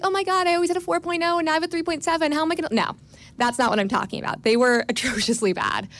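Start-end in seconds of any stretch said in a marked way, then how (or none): 0.0-2.7 fast, high, mocking a fussy overachiever; mock panicked student. Law student mocking classmates fretting over small grade drops, fast mock panic. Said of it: "I don't mean, like".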